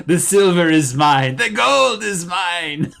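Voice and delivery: slow, loud, booming declaration with a laugh; grand scripture proclamation. Pretend. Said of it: "go look up Haggai".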